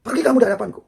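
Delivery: loud, high-pitched retold outburst; storyteller imitating a furious shout; angry Umar. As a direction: act out an angry banishment at full volume. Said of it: "Wajar Sayyidina Umar tuh marah".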